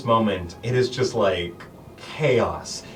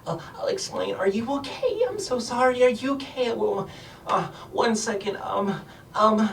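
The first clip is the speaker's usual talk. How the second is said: high, soft, worried little voice; a tiny girl fussing over the injured; flustered gnome girl; 12 semitones higher, 3 dB quieter than usual. Acted. Gnome girl fusses over the party.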